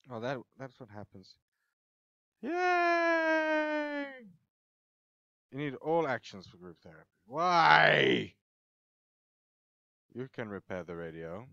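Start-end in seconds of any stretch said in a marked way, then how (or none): 2.4-4.1 high, drawn-out yay; cheer. Real, not acted. Player celebrating in a game, high drawn-out cheer. 7.3-8.3 loud, high cry of protest; exasperated shout. Real, not acted. Player protests a game setback, exasperated shout.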